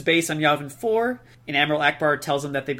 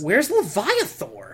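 mock outrage; 10 semitones higher, much slower than usual. Mock fan outcry.

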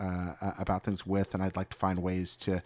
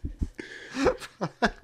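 high laugh; 14 semitones higher than usual. Real, not acted. Laughing at own accent.

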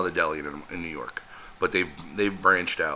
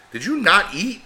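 mock surprised question; 13 semitones higher, 7 dB louder than usual. Asking stepfather in disbelief.

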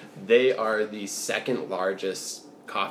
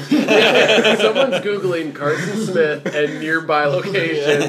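laughing; 6 semitones higher, 7 dB louder, slower than usual. Real, not acted. Laughing joke.

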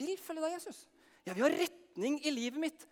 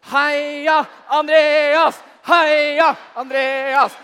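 huge, slow, drawn-out shouted chant; all-out cheer; 4 semitones higher, 15 dB louder, much slower than usual. Acted. Whole room cheering Andreas on, full-throated shouted chant. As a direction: cheer for Andreas as loud as you can. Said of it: "tidenes cheering her".

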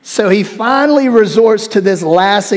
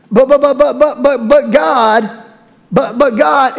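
higher, sputtering "but, but" protest; stammering Moses; 5 semitones higher than usual. Acted. Moses sputtering excuses at God, stammering. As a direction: sputter helplessly like a cornered man.

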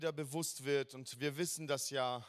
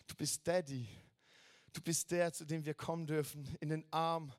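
ordinary delivery

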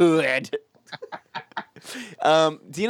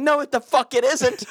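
much higher, indignant protest; mock outrage; 10 semitones higher than usual. Arguing with the British over spelling, mock indignant. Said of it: "I'm like".

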